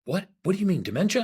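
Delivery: sudden high, loud alarmed question; imitating a startled man; alarmed surprise. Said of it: "He was like".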